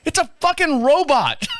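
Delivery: high-pitched disbelieving protest; incredulous retort. Retold or imitated. Husband retorting to his wife, high-pitched and incredulous. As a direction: squeak back in disbelief. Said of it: "arguing back with wife".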